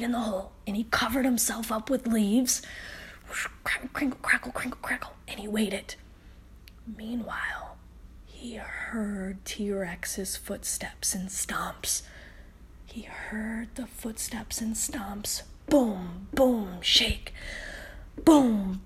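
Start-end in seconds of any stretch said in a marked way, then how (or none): 3.6-15.4 soft, hushed storytelling; hushed bedtime suspense; suspense whisper. A narrator's reading. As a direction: whisper as if hiding. Hiding under leaves, suspense.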